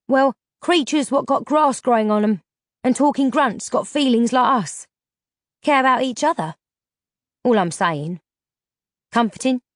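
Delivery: a lightly voiced plain-spoken country lad; a hint of a rough young man, musing; Delph's rustic voice. Delph muses aloud to Vega, lightly voiced country lad.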